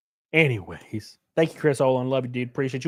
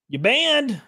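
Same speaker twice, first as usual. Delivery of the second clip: higher, mock-stern you're banned; 8 semitones higher than usual. Real, not acted. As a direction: declare the ban with hammed-up sternness. Mock banning chatters.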